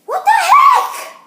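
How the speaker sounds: high, loud, stretched laughing yell; laughing shout. Real, not acted. Kid startled while spreading icing, slow laughing shout. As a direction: cry out while cracking up.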